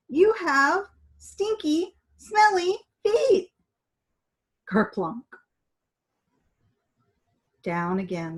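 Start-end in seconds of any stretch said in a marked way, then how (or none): 0.1-3.5 high, slow, loud complaint; a prim lady yelling in disgust; shrill lady's shout. A narrator's reading. Dolores scolding Douglas about his smelly feet, high and slow.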